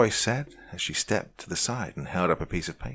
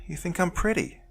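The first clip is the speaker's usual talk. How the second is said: high, bright little chirp; chirpy; 12 semitones higher than usual. A narrator's reading. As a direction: chirp it playfully. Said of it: "she chirped".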